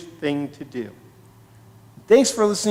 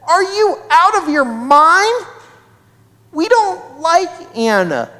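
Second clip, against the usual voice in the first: put-on high, incredulous girl's retort; indignant refusal; 11 semitones higher, 3 dB louder than usual. Stephanie refusing to help a girl she dislikes, indignant. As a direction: scoff at the idea in a raised pitch.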